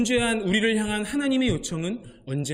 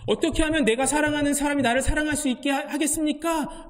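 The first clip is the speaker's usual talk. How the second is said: higher, pleading and a bit desperate; Bruce's pleading question; 8 semitones higher, 3 dB louder than usual. Retold or imitated. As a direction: ask it as a cry for help, slowing at the end. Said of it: "라고 말한 이 브루스의 질문".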